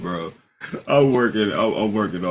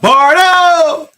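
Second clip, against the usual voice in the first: big booming arena-announcer call of the name, much higher; hype shout; 12 dB louder than usual. Acted. Host introducing his main man, booming announcer call. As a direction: boom it out like a ring announcer. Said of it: "bring him in the right way".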